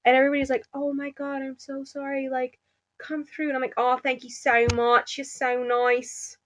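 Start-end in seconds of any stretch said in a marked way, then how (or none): 0.7-3.5 soft, higher mimicked apology; imitated sympathetic strangers. Strangers waving her through, softly mimicked apology. 3.8-6.4 gushing thanks in put-on Australian; Australian accent. Shown as a demonstration. Thanking the crowd, slow put-on Australian accent. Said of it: "I put on my Australian accent".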